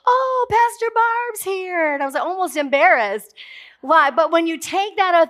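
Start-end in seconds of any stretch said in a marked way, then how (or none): 0.1-1.9 much higher, slow gushing welcome; high, drawn-out delighted hello; delighted greeting. Retold or imitated. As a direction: gush like the women thrilled she came. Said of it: "I walk in the room".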